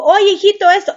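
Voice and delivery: a lightly hammed mother eager to give the answer; insistent mom. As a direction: push in to help her son. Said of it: "le digo".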